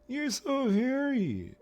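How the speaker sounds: very high, drawn-out mock Capitol voice; slow, loud, squeaky put-on voice, dropping at the end; high-pitched Capitol voice. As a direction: pipe it slowly, far up in pitch. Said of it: "speak in such a high pitch".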